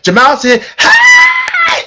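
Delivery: high squealed hi with a shout; excited shout. Retold or imitated. [name] retelling Monique's high, shouted excited greeting. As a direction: shriek the greeting with joy. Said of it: "[name] said".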